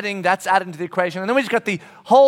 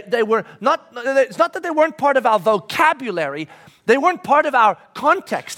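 higher, swelling sermon delivery; emphatic preaching; 5 semitones higher, 4 dB louder than usual. Real, not acted. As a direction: preach it with rising conviction, leaning on key words.

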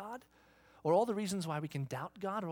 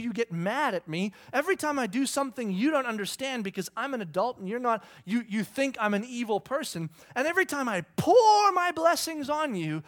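put-on loud, higher, disbelieving rant at the kids; exasperated dad; 6 semitones higher, 8 dB louder than usual. Dad venting at ungrateful kids, exasperated and loud. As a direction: let the frustration spill over. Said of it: "you start looking at your kids going".

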